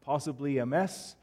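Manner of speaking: usual speaking voice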